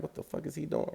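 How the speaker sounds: high, soft mimicked bystanders; baffled onlookers. Bystanders staring at him running back, high hushed bafflement.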